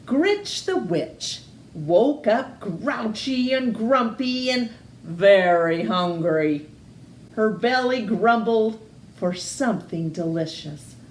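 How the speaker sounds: ordinary delivery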